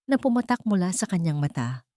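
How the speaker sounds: ordinary delivery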